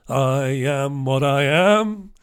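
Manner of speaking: ordinary delivery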